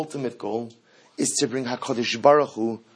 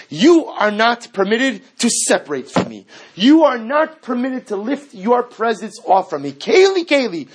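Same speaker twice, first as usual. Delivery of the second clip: high, loud, insistent sermon voice; fiery sermon at full force; impassioned preaching; 8 semitones higher, 7 dB louder than usual. Acted. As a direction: drive it home to the congregation.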